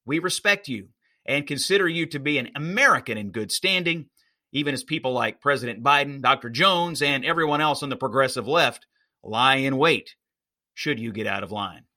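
ordinary talk, nothing marked

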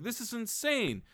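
a squeaky, outraged, slowed complaint; exasperated high voice. Retold or imitated. Exasperated main character quoted.

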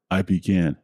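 plain reading voice

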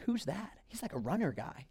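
admiring onlookers murmuring. Retold or imitated. Onlookers whisper about the runner guy, hushed. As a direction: mutter it like bystanders in awe. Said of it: "and they're like".

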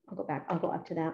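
usual speaking voice

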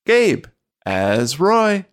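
drawn-out, swooping announcer delivery; show announcer. Acted. Host introduces the players to listeners, announcer style. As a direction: announce the cast with big stretched names.

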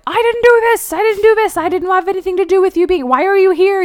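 a gangster cracking under pressure, shrieking; losing it. Retold or imitated. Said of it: "He's, like, losing it".

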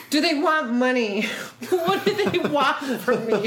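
hammed-up suspicious self-mockery; mock selfish brat. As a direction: play the selfish brat, half laughing. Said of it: "a little selfish little brat that I am".

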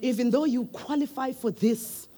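soft, low, kind manager voice; gently relieve a tired employee of the work; gentle boss. Acted. Manager at the boardroom table softly reassures an overworked employee. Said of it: "And then you say".

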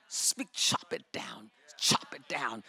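low, slow whisper of chop it down; whispered command. Acted. Preacher whispering to the congregation's mountain, hushed. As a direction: hush it out like a secret order.

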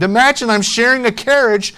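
high, loud, thrilled amazement; delighted exclamation. A narrator's reading. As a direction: build excitedly to a high, ringing finish. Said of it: "she exclaimed".

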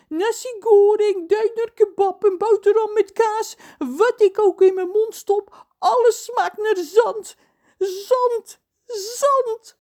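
high, loud tearful wail; sobbing. Acted. Cook John sobs about the curse to the children. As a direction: sob it out like a heartbroken cook. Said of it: "snikt hij".

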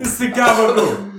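high loud Italian-American; hammed-up mobster; Italian accent. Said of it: "they both sound like the fucking legit".

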